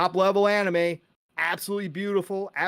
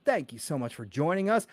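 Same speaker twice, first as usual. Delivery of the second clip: normal talking voice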